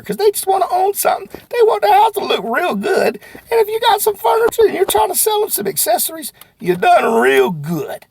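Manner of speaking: high and loud hype; cheering on salespeople; excited. Real, not acted. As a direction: build up the energy. Building to motivational close.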